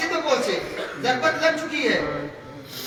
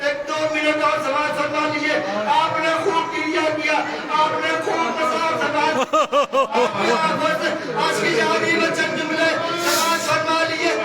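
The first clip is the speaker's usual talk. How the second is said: raised, chanted lament told to the mourners; mournful majlis recitation; 4 semitones higher than usual. Acted. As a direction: wail the story out as a grieving chant.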